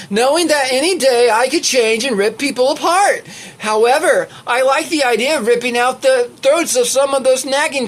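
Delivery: high, animated, hammed-up character read. Reader griping as the character about nagging customers, hammed-up high voice. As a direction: pitch it up, building to mock outrage at the customers.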